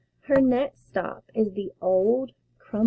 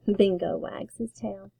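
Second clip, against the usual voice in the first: usual speaking voice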